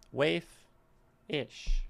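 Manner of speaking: Waif-ish in two separate beats, WAIF, then ish; split into syllables. Shown as a demonstration. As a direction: break Waif-ish apart with a pause. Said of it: "Waif is the main word".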